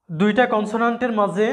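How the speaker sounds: normal talking voice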